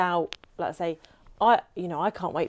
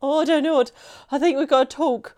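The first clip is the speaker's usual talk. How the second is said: high, louder mock panic at having to talk; flustered self-imitation; 7 semitones higher, 5 dB louder than usual. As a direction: act out your own awkward fluster on camera. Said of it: "'cause I, I go like that".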